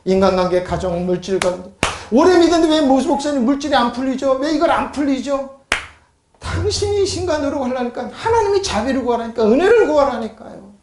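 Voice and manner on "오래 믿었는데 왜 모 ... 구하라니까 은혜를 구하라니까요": high, pressing pulpit questions; insistent preaching. Real, not acted. Preacher asks believers why money troubles persist, insistent and high. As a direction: press the congregation in a high pitch.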